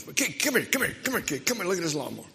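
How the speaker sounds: put-on high, loud call to the kid, rushing at the end. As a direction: holler the kid over, then spill the complaint. Pastor calls out to kid.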